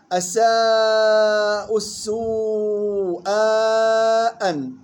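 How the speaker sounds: very slow chanted أساء السوء with held vowels; long madd. Shown as a demonstration. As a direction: hold "saa" and "suu" long in أساء السوء. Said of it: "الانتباه للمدود وصلا وقفا". (أساء السوء بمدود طويلة)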